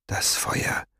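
breathless hushed whisper. A narrator's reading. Farid whispers to Staubfinger about the fire, hushed. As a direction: breathe the words out without voice. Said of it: "flüsterte er".

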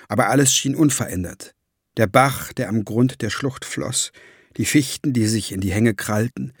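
ordinary delivery, nothing marked

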